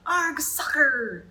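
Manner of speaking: high, loud, slow pirate roar; a crew hamming up a pirate cheer; yelled. A narrator's reading. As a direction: drag out the arg like a cheering pirate. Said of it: "kicked the ball and yelled".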